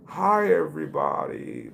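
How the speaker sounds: slow, odd, spaced-out greeting; greeting the dispensary staff while high; weird stoned voice. Retold or imitated. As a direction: sound too high to talk right. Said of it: "My voice was all weird".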